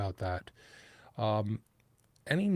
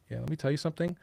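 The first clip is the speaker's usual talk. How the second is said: New York accent; 4 semitones higher, much faster than usual. Shown as a demonstration. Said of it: "the New York accent".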